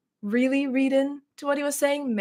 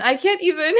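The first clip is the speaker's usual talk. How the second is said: higher, half-laughing, half-crying; laughing fake sob; laughing mock despair; 6 semitones higher, 5 dB louder than usual. Shown as a demonstration. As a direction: crack up as you repeat it. Reading a viewer's comment, second take.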